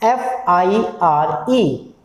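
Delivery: F, I, R, E spelled slowly letter by letter. Shown as a demonstration. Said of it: "ठीक से पढ़ते हैं". ("एफ़ आई आर ई" धीरे, एक-एक अक्षर)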